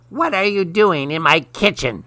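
high-pitched storybook witch voice; snapping witch. A narrator's reading. Witch finds a child in the kitchen, snapping. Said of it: "snapped the witch".

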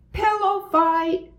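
high-pitched excited cry; screamed. A narrator's reading. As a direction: scream it out high, starting the fight. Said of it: "screamed Buster".

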